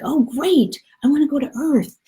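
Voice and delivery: put-on high-pitched childlike enthusiasm; eager little soul. Little soul learns of Earth, eager and high-pitched. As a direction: jump at the offer in a high little voice. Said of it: "he's like".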